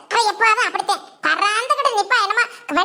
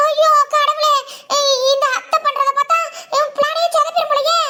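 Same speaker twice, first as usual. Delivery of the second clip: mock despair; 4 semitones higher than usual. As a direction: whine that the plan is falling apart. Plan spoiled by the aunt.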